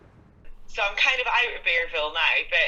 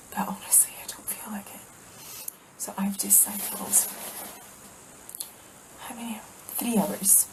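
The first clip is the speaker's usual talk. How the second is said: weary unvoiced whisper; 2 semitones higher, 6 dB quieter than usual. Real, not acted. Exhausted after cycling, whispering to camera. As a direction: whisper it, too tired to speak up. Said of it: "I don't know why I'm whispering".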